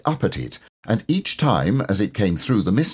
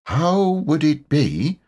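slow and thoughtful; slow Pooh; 7 semitones higher, slower than usual. A narrator's reading. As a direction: Pooh working it out slowly. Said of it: "said Pooh slowly".

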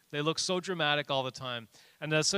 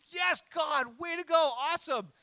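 much higher, loud victorious whoop; excited cheer; 12 semitones higher, 4 dB louder than usual. Pretend. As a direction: cheer God on like a fan. Imagined cheer after a victory.

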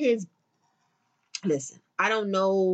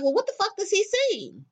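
incredulous question; 9 semitones higher than usual. Retold or imitated. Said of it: "I was just like".

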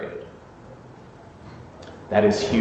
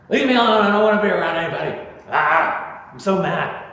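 hurt outburst; 6 semitones higher than usual. Retold or imitated. Someone hurt by a loved one blurting out in anger. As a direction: blurt it out like a wounded lover. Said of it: "if you say it at all".

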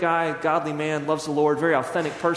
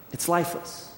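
whispered, soft; a breathy whisper to land the point; hushed; 6 dB quieter than usual. Real, not acted. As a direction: let the word die away.

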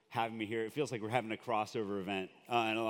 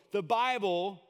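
voicing Jesus; 6 semitones higher, 4 dB louder than usual. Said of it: "this carpenter from Nazareth go".

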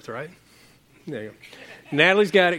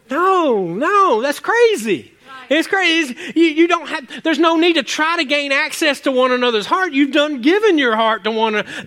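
emphatic preaching; 7 semitones higher than usual. Acted.

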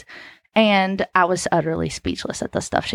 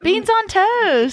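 mock disbelief; 11 semitones higher, 4 dB louder, much slower than usual. Mocking the British breakfast.

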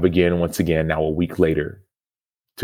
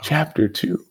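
incredulous; 4 semitones higher, slower than usual. Retold or imitated. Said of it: "in my mind, I'm like".

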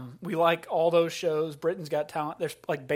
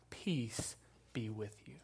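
slow, soft whisper; whispered blessing; 6 semitones lower, 14 dB quieter than usual. Acted. Jesus greeting his frightened disciples, in a slow soft whisper. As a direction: breathe the words like a gentle blessing.

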